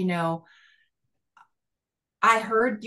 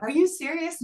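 high, astonished "are you serious"; gasping in disbelief at a friend; amazed disbelief; 11 semitones higher than usual. Retold or imitated. Chatting with a woman from the meeting, high astonished disbelief. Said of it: "I'm like".

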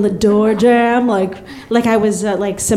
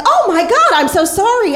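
high shocked apology; 8 semitones higher than usual. Retold or imitated. Apology she didn't give.

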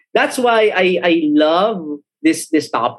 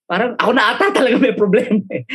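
laughing; 6 semitones higher, much faster than usual. Real, not acted. Laughing at himself.

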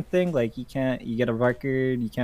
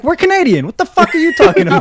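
very high, loud indignant comeback; hammed-up shouted retort; mock outrage; 10 dB louder than usual. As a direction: imitate an outraged parent, pitched way up. Parents flip, retold outraged comeback.

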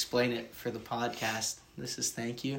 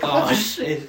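laughing, much louder realization; laughing oh shit; 4 semitones higher, 12 dB louder than usual. Real, not acted. Caught out in class, laughing realization. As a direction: crack up at your own blunder.

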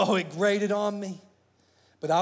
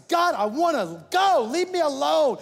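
angry protest; 8 semitones higher, 6 dB louder than usual. Retold or imitated. Preacher retelling yelling at God to leave him alone. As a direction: throw it at God in frustration.

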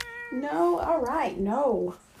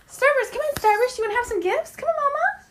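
much higher, coaxing call; high pet call; 8 semitones higher, faster than usual. Real, not acted. Owner coaxes her cat to come for gifts, high. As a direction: coax the cat over in a sing-song.

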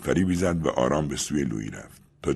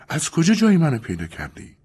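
higher, alarmed woman; startled Julia; 11 semitones higher, 3 dB louder than usual. Acted. Julia confronting Louis, alarmed. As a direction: demand to know how he found her.

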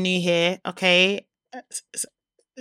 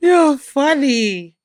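laughing; 6 semitones higher, 7 dB louder, much slower than usual. Real, not acted. Laughing at co-host's act.

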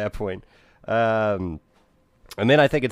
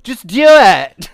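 much higher, louder, slow command; hammed-up insistence; 11 semitones higher, 13 dB louder, much slower than usual. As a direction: belt it out stretched. Acting the insistence.

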